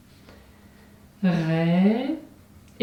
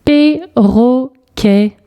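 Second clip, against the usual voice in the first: "Perroquet" very slow, split pe, rro, quet; syllable by syllable; 3 semitones higher, much slower than usual. Shown as a demonstration. Teacher correcting the syllable exercise, syllable by syllable. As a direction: break "Perroquet" into three separate beats. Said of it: "les syllabes où tu entends".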